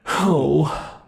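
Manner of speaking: normal talking voice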